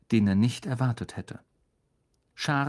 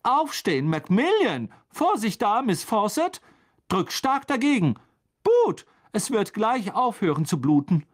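high, raised, urgent teacher voice; calling out orders; 9 semitones higher, 4 dB louder than usual. A narrator's reading. Teacher to pupils after a fight, calling out orders. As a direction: call out commands across a hall.

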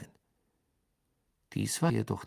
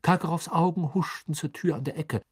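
ordinary delivery, nothing marked

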